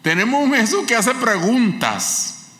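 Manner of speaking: high, loud pulpit fervour; fervent preaching. Acted. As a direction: lift the church with every phrase. (predicación ferviente, aguda y fuerte)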